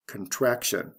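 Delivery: "contraction" stressed con-TRAC-tion; stress before the ending. Shown as a demonstration. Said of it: "stress is right before the T I O N ending".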